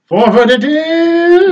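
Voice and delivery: rallying cry. Acted. Character declares the way forward.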